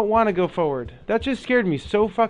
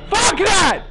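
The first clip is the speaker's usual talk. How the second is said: terrified shout; 9 semitones higher, slower than usual. Real, not acted. Gamer spotting a ghost lady, terrified shout. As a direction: scream it at the sudden figure.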